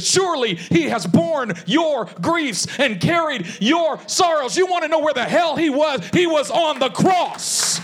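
high, slow shouted proclamation; impassioned preaching. Retold or imitated. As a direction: drive each word home like a preacher at climax. Sermon climax, preacher answers the question.

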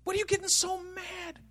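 high, soft incredulity; baffled. Acted. Onlooker to someone suddenly mad, baffled. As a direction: ask it in a small, high voice.

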